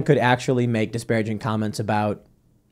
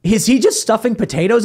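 higher, disbelieving question; incredulous imitation; 9 semitones higher than usual. Roommate staring at the screen, high disbelieving question. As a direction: ask it up high in mock disbelief.

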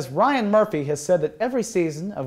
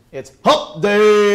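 loud, slow, stretched-out cheer; Miss Judy's voice; 5 semitones higher, 8 dB louder, much slower than usual. Retold or imitated. Host imitates the cafeteria lady announcing hump day, loud and slow. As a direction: draw it out big and slow like a jolly lunch lady. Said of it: "She did that exact voice".

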